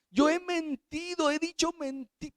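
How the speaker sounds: put-on a nine-year-old crying out to her mother; crying little girl. Girl sobbing to her mother over her sins, high and pleading. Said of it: "esa niña llorando decía".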